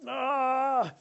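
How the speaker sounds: a sudden high frustrated "Ah!"; mock angry yell. Father showing his kids how mad he gets, mock yell. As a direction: burst out like a fed-up parent. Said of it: "I'm like".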